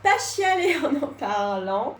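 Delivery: slow laugh through tears; tearful laugh. Real, not acted. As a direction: laugh so you don't cry. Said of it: "je vais essayer de ne pas chialer".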